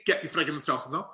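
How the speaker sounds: fast, guttural Scouse recital; Liverpudlian accent. Retold or imitated. Actor starting his audition line, quick Liverpudlian. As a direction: rattle it off in a throaty Liverpool accent. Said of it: "ridiculous Liverpudlian accent".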